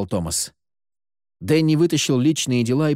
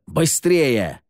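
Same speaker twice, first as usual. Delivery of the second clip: higher-pitched, impatient command; urgent order; 4 semitones higher than usual. A narrator's reading. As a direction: cut in and hurry him along. Impatient order under fire. (нетерпеливо подгоняя)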